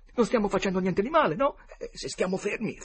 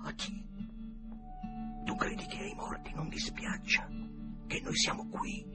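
hushed, voiceless whisper; 4 dB quieter than usual. A narrator's reading. Tom asking Huck about the dead, in a whisper. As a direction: whisper it under your breath. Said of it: "disse allora Tom in un sussurro".

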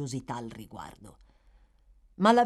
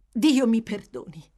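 anguished exclamation; 6 semitones higher, 9 dB louder than usual. A narrator's reading. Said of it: "esclamò poi".

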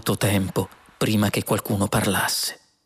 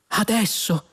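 eager; 10 semitones higher than usual. A narrator's reading. Eager men press the computer.

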